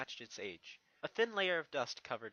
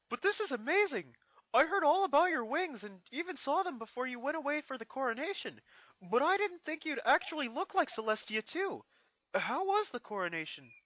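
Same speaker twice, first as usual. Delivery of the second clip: put-on high, louder, eager boy voice; excited little dragon; 13 semitones higher, 7 dB louder than usual. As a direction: gush about the wings. Spike excited about wings.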